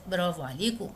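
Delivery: ordinary delivery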